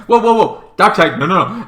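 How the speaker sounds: mock panic pleading; hammed-up alarm. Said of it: "It's like".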